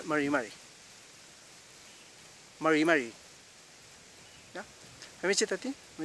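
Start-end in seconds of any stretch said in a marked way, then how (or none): 0.0-3.1 MARI MARI with untrilled, English-like r; correct Mapuche r. Shown as a demonstration. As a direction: model MARI MARI for the learner to echo. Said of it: "dígame".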